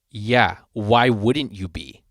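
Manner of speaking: slow, drawn-out condescending reply; retold deadpan put-down. Sound guy answering the singer's mic check, slowly condescending. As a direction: imitate him, stretching out the question. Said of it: "he said it just like that".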